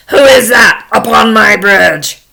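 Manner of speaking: loud, shouted troll challenge; menacing troll bellow; horrible troll. Acted. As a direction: shout it like a monster guarding its bridge. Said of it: "there was this horrible troll".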